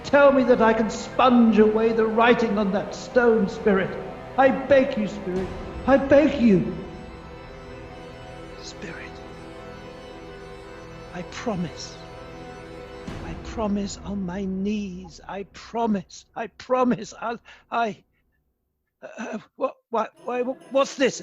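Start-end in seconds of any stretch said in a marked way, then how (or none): 0.0-6.8 urgent, impassioned begging; frantic, forceful plea; desperate pleading. Acted. Pleads with the spirit for mercy. 8.7-17.1 soft, faltering promise; hushed, desperate vow; broken pleading. Acted. Broken plea on his knees.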